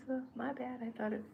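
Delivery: lightly voiced goat owning up; sheepish apology. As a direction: shrug it off like a guilty goat. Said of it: "He says".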